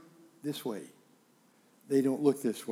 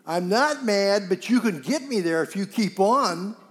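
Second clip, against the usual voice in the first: loud, high, heated denial; angry denial; 6 semitones higher, 9 dB louder than usual. Retold or imitated. Husband insists to wife he isn't mad, angry shout. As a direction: deny being mad while clearly losing your temper. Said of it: "You sure seem mad to me".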